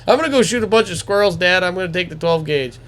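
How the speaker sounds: quick, high-pitched youngster; imitating a kid announcing plans; eager kid. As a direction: rattle it off high and fast to Dad. Acting eager son asking dad.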